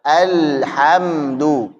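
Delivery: "Alhamdu" very slow, l held before "hamdu"; parked lam. Shown as a demonstration. Teacher models for students, slow held "Alhamdu". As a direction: stretch "Alhamdu", no bounce on the l. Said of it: "parkir di situ dulu".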